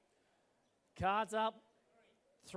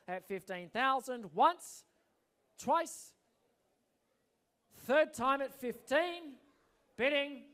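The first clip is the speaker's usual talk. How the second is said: slow, higher, drawn-out auctioneer call; slow closing call; 5 semitones higher, 4 dB louder, slower than usual. Real, not acted. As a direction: stretch each word as the hammer nears.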